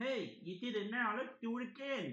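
high, slow cartoon helper; game character voice. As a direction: chirp instructions like a game sidekick. Voicing a game character's repeated cutscene.

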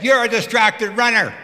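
yelling back. Retold or imitated. Cyclist shouting back at the runner with earplugs, loud. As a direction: shout it back at her retort-style. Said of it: "So I yell back at her".

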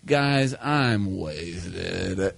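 very slow, low, stretched-out announcement; dawning realization. Acted. Tripping teen announces a realization to his friends, slow and low. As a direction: drag the words out low, as if intoxicated. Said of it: "a bell goes off in my head".

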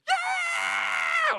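very high, loud rising shout; joyful whoop. Retold or imitated. Maverick with arms up on the ride, very high joyful yell. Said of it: "and he's just".

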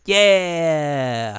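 loud, high, long drawn-out yeah sliding down; enthusiastic cheer. Real, not acted. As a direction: cheer it, stretching the vowel. Cheering on being nerds together.

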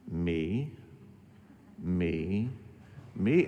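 low, soft, slowly spaced murmurs; retold self-recognition. Pastor reading about narcissism, recognising himself, low and soft. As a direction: quietly admit it, one "me" at a time. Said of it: "I started going".

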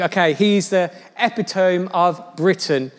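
ordinary delivery

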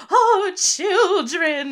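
put-on high, loud, slow gushing old lady; gushing grandma. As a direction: swoon over the children like a doting grandma. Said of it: "does her, like, gasps".

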